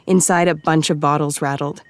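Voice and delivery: usual speaking voice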